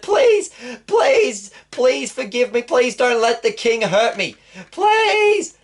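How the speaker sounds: very high, whining pleas for mercy; a terrified villain squealing for his life; desperate begging. Acted. Haman to Esther, begging forgiveness, high panicked pleading.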